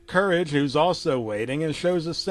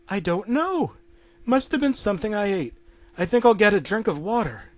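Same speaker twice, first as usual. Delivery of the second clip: lightly voiced higher unwell wife; Muriel uneasy; 6 semitones higher, faster than usual. Sick wife woken at night explaining herself, mildly uneasy. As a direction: answer in a higher, uncomfortable voice.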